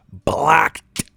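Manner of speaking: loud, high one-word verdict; explosive dismissal; emphatic shout. Real, not acted. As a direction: shout it like slamming a door. Ending rant at critics.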